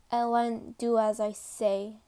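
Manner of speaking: low, loud, firm demand; Levi. Acted. Corporal demanding that the cadet obey, firm and low. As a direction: demand obedience in a deep, forceful voice. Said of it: "Levi demanded".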